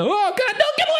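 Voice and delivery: very high panicked squeal; host shrieking at the charging goat; terrified shriek. Acted. [name] shrieks as the goat attacks.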